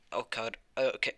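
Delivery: ordinary delivery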